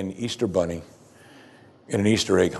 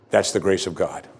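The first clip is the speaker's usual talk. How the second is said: ordinary talk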